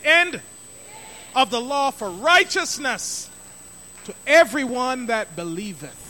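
loud, slowed call-and-response reading; lead the verse aloud. Shown as a demonstration. Preacher leading congregation to recite verse, loud and slow. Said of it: "let's say it".

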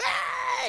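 high-pitched imitation shriek, held back in volume; imitated scream. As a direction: mimic a woman screaming on the floor. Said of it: "she's screaming everything".

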